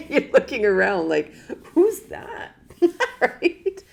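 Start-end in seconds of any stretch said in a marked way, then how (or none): 1.7-3.8 high and loud, laughing through it. Pretend. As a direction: ask it while cracking up.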